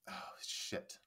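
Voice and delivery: a breathless whisper to herself; real muttered slip whispered aside; whispered curse. Real, not acted. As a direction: hush the curse under your breath. Forgets name mid-sentence.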